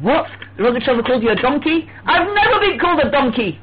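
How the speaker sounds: rising incredulous outburst, peaking high; mock astonishment. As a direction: act scandalized on hearing the insult. Acts out shocked reply to complaint.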